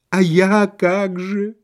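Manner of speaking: slow, high wounded plea; self-pitying husband; plaintive whine. A narrator's reading. Abandoned husband wails at wife.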